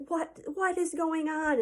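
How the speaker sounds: slow, high-pitched dad's reaction; alarmed dad. Retold or imitated. Dad finds the kids coming home crying, alarmed. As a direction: imitate a startled parent, drawing it out. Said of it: "my dad's like".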